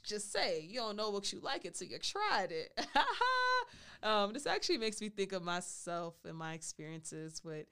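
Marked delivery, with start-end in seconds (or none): 2.8-4.0 high, loud laugh. Real, not acted. Laughs after teasing intro.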